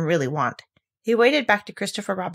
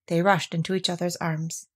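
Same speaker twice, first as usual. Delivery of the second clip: ordinary delivery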